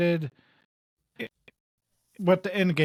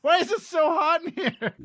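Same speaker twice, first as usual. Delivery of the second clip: complaining about heat; 10 semitones higher than usual. Retold or imitated. Retelling himself sweating at grandpa's.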